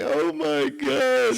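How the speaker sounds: high, drawn-out mock cry; mock tearful. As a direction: imitate someone overcome, slow and high. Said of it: "oh, she started crying".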